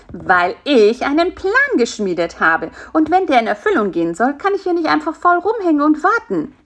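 bursting to get going; eager and driven. A narrator's reading. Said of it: "antwortete Bisi voller Tatendrang". (voller Tatendrang, immer höher und lauter)

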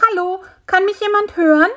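high, quick, small creature's voice; high-pitched little insect calling; little fly calling out. A narrator's reading. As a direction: call out hopefully to anyone who might hear.

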